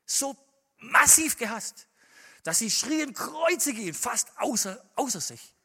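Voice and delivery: loud, high fiery sermon; preaching at full force. Acted. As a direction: hammer each phrase home to the congregation.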